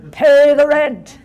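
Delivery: mustache twirling villain. Pretend. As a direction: bellow it like a melodrama baddie. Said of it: "this childish parody of melodrama".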